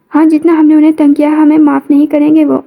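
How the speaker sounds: ordinary delivery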